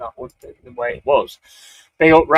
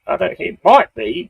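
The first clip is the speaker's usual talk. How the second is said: ordinary delivery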